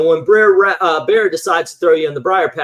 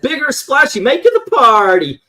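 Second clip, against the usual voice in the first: high, loud sing-song mockery; mocking ride voice; 9 semitones higher, 5 dB louder than usual. Mocking the new ride's announcer, high sing-song. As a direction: parody the ride's cheery script. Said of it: "it's like".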